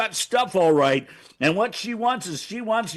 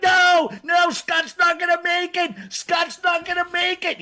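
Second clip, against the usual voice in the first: mock panic; 11 semitones higher, 4 dB louder than usual. Panicked escape from aliens.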